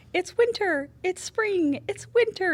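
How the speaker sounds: high squeaky voice for the fickle weather; weather talking. Pretend. Voicing the weather that keeps changing, high squeaky cartoon voice. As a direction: play the weather as a silly squeaky character.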